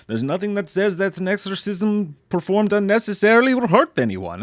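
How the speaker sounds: light Italian-tinged priest voice; a hint of the exorcist's accent; priest's accent. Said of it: "he's like".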